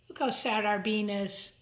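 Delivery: usual speaking voice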